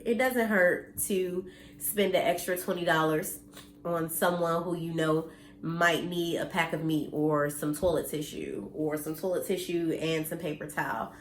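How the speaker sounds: ordinary talk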